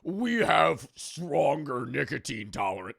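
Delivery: slow mock-bragging voice; put-on, drawn-out boasting. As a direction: drag it out like a braggart. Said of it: "let's not be like".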